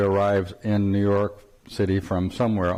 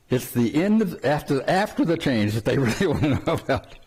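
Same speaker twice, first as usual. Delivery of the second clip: breaking into laughter; 5 semitones higher than usual. Real, not acted. Teacher jokes to students about what people want to know, breaking into laughter. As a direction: laugh through the end of the sentence. Joking aside ends in laughter.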